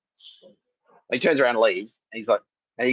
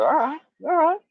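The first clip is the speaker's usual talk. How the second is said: very high mock-defensive squeal; exaggerated high voice, placating. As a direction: act out fending someone off, high-pitched. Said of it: "I get the defensive stuff".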